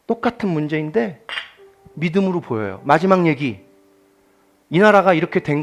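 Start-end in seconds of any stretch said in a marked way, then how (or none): none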